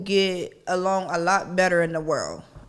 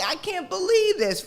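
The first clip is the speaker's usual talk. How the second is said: disbelief; 9 semitones higher than usual. Retold or imitated. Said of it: "'cause I'm just like".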